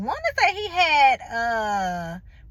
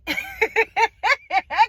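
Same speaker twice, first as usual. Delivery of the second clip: exasperated laugh; 7 semitones higher, 4 dB louder than usual. Real, not acted. Frustrated laugh mid-story.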